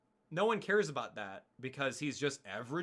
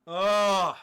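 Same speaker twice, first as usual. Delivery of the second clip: frustrated groan; 5 semitones higher, 12 dB louder than usual. Real, not acted. Frustrated groan over injustice.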